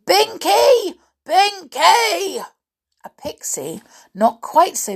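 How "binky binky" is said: high, booming call for a servant; loud shout. A narrator's reading. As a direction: bellow the name, voice leaping up. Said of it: "shouted so loudly".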